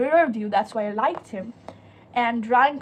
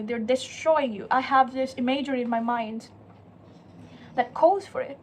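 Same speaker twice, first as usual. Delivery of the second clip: normal talking voice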